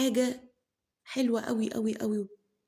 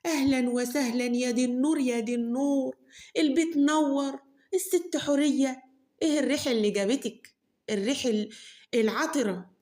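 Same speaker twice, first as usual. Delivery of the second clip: fake welcome; 5 semitones higher, 4 dB louder than usual. Mother fakes a warm welcome to Khala Horeya, gushing. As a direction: overdo the hospitality, clearly insincere. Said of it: "والترحيب الكداب".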